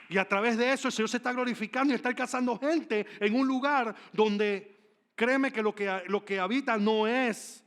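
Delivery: high, emphatic pulpit delivery; impassioned preaching. Real, not acted. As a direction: preach it out with full force. Preaches at full force.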